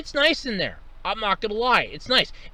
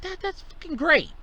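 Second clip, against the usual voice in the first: sarcastic; 9 semitones higher than usual. Retold or imitated. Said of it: "I'm like".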